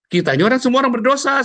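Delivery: high, loud and quick, brushing the guilt aside; emphatic retort. Retold or imitated. Pastor reassuring a shamed member, loud quick retort. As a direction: fire it back fast and bright.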